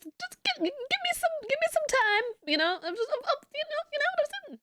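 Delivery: squeaky plea. Real, not acted. Streamer pleads with chat for time, squeaky. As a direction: plead in a tiny high voice.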